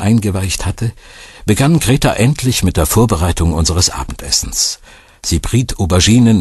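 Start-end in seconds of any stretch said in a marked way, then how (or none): none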